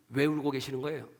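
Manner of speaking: usual speaking voice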